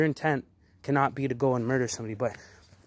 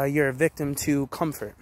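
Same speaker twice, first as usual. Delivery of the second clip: ordinary talk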